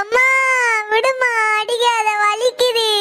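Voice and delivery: put-on a beaten child begging her to stop; crying in pain. Child begs the other mother to stop hitting, crying.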